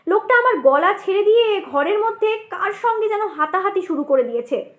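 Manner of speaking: normal talking voice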